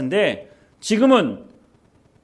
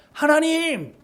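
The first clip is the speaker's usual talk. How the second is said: much higher desperate cry upward; shouting at heaven; 10 semitones higher, slower than usual. Retold or imitated. Moses shouts to the silent sky, high desperate cry. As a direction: cry out to an unanswering God. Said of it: "소리를 질러도".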